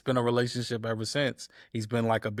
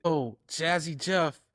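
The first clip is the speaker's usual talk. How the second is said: higher, fast, thrilled rush of words; excited gushing; 7 semitones higher than usual. Retold or imitated. Friend posting online, imitated excited gushing. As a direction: gush like you can't believe your luck. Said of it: "he's going off".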